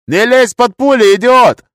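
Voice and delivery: very high, loud, drawn-out yell; furious shout. A narrator's reading. Gunner scolding wounded comrade under fire, high angry shout. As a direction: yell the warning in alarm.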